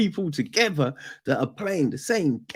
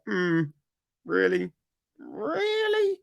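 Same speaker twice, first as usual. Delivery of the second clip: rising doubtful really, higher each time; sceptical; 6 semitones higher than usual. Retold or imitated. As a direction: doubt it, squinting at the screen. Sceptical reaction to plot.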